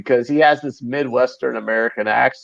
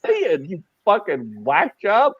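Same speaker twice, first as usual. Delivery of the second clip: slow, high-pitched retold outburst; incredulous, half cracking up; laughing mock disbelief; 7 semitones higher, slower than usual. Retelling his reaction to a weird coworker, laughing disbelief.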